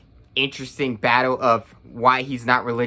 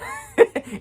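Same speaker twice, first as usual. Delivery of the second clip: a sudden high laugh; high burst of laughter; 12 semitones higher than usual. Real, not acted. Reviewer laughing at the film's ending, high laugh. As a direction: laugh in disbelief at the plot.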